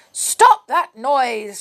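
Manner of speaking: slow, loud snapped command; cross witch cry. A narrator's reading. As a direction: shout it down from the window. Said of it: "she cried".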